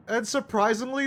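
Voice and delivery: ordinary talk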